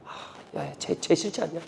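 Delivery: very hushed, conspiratorial murmur; whispering gossip. Acted. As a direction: slip malicious gossip into a friend's ear. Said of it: "막 소근소근합니다". (소근소근 속삭이듯)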